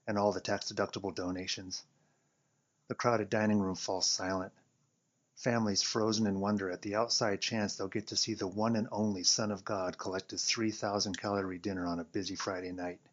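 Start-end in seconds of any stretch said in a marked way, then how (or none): none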